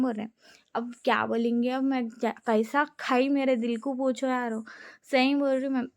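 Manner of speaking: ordinary talk, nothing marked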